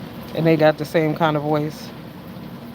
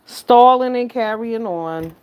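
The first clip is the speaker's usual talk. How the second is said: slow, savoring repeat; 3 semitones higher, slower than usual. Shown as a demonstration. Repeating her own words back slowly for effect. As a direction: drag the phrase out with relish.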